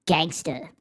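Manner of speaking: normal talking voice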